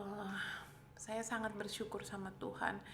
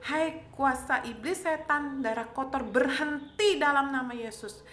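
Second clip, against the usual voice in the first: loud, raised exorcist-like command; rebuking prayer; 3 semitones higher, 8 dB louder than usual. Retold or imitated. Patient rebukes the devil behind her bleeding, retold commanding shout. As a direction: hurl each phrase as a forceful order.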